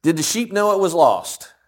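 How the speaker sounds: ordinary delivery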